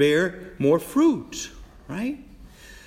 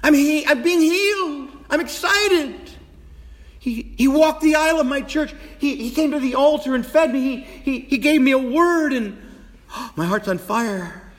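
fervent preaching; 12 semitones higher, 4 dB louder than usual. Acted. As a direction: cry it out like a man set on fire. Preaching at full force.

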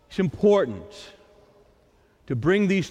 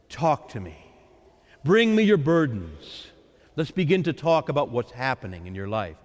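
gentle Lord; 7 semitones lower than usual. Acted. The Lord invites to talk.

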